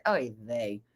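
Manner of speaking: low, soft mock disappointment; disappointed voice. Shown as a demonstration. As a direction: let it sink, quietly let down. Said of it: "that's my disappointed voice".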